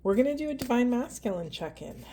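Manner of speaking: ordinary talk, nothing marked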